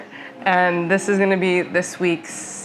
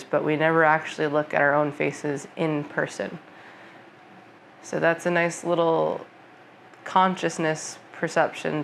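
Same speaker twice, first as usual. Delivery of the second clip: calm, softer meditation voice with long pauses; guided meditation; 5 dB quieter than usual. Acted. Yoga teacher guiding viewers through a senses meditation, calm guided meditation. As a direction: ease them into the breath and senses, unhurried.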